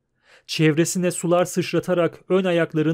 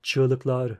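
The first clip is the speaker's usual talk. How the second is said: plain reading voice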